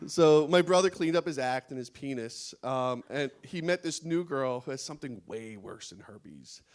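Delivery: usual speaking voice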